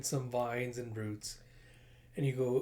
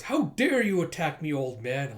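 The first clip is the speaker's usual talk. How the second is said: outraged villain; 2 semitones higher, 5 dB louder than usual. Acted. Villain attacked by an old man, outraged. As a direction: demand it in affronted fury.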